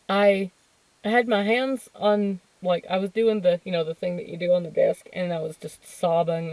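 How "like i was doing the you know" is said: ordinary talk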